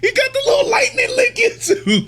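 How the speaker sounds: very high, loud, giddy excitement; high-pitched gleeful cry, close to laughing; hyped-up squealing. Real, not acted. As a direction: squeal it like an overexcited fan. Hyped reaction to young Garp.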